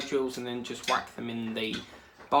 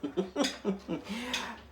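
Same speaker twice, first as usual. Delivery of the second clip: laughing; 9 semitones higher than usual. Real, not acted. Laughing at mum story.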